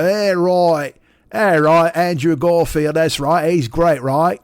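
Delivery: loud, hammed-up Cockney idiot; bad Cockney accent. Shown as a demonstration. Podcaster showing his awful attempt at English accents, hammy Cockney. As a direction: overdo the Cockney like an extra in a pirate movie. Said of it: "I turn into a Cockney fucking idiot".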